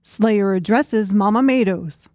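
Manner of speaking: ordinary delivery, nothing marked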